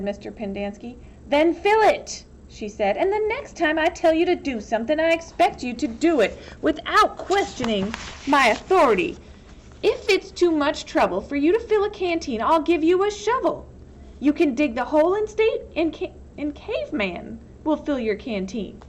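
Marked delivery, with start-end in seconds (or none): 1.3-2.2 loud, high snapped order; sharp command. Acted. Warden ordering the counselor, a loud sharp command. 3.0-18.8 raised, high, hard reprimand; angry warden. Acted. Warden scolding the counselor, raised and hard.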